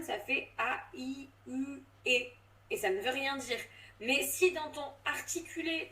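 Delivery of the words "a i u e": vowels alone, no consonants; bare vowel sounds A, I, U, E; vowels only. Shown as a demonstration. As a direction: say just the vowels A I U E. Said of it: "Si tu n'as pas de consonne".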